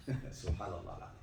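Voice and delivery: normal talking voice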